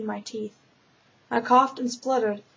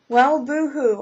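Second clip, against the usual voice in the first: sarcastic boo-hoo, louder and mocking; mock sympathy; 3 semitones higher, 6 dB louder, slower than usual. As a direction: tease her grief with a scornful boo-hoo. Presence mocks her self-pity.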